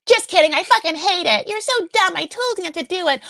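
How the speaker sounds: high, loud nagging mother voice; mock angry mother. Imitating Molly scolding Forrest about the engagement. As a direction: sneer it like a nagging mom. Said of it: "And Molly's like".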